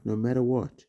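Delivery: ordinary delivery, nothing marked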